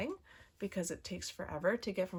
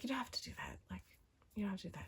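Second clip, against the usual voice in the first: retold whisper; 11 dB quieter, much faster than usual. Polite refusal of a gift, retold.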